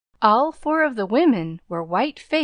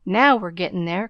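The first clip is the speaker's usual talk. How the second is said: ordinary delivery, nothing marked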